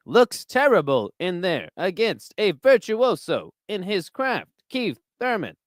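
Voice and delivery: halting, grandiose ringside call with big pitch arcs; Howard Cosell commentating. Acted. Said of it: "Impression of Howard Cosell commentating".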